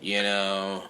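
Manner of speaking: slow stoner drawl. Shown as a demonstration. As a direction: drag it out like a stoner. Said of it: "I don't get all low like this".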